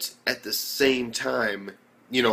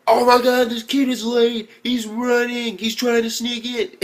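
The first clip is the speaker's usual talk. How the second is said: high, loud, frantic alarm; excited yelling; 12 semitones higher, 7 dB louder, slower than usual. Retold or imitated. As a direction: shout it like you just spotted the late kid. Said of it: "Out of nowhere, Miyuki".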